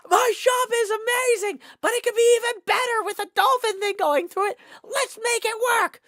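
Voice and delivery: very high, loud excited shout; B-Dubs voice. Retold or imitated. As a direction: squeal it like an overjoyed shopkeeper. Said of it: "B-Dubs is like".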